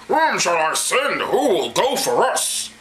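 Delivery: slow, booming mock-divine call; drawn-out hammy voice of God; God's voice. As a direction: intone it grandly as the Almighty. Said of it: "Sorry, God".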